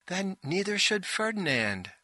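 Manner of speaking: high-pitched directing voice, theatrical; imperious director. A narrator's reading. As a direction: overrule the actor in a high stagey voice. Bossy director correcting her actors.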